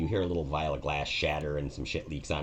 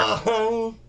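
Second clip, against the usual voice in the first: a high, loud mock clong of a struck lock; metal clang; 8 dB louder than usual. GM imitating the chest being hit, high loud clong. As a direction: ring it out like a struck iron chest. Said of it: "like, clong!".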